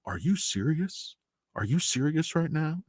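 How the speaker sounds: soft, deadpan mock incredulity; hushed, questioning imitation of a baffled witness; imitated quiet disbelief. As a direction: play John Kerry quietly taken aback. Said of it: "takes down his glasses".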